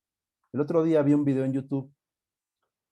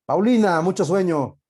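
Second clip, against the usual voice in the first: loud, higher call to a student; 4 semitones higher, 9 dB louder than usual. Real, not acted. Teacher calls out a student dozing in class, loud call-out. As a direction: call sharply across the classroom.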